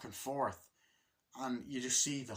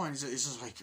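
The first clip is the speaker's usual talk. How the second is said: normal talking voice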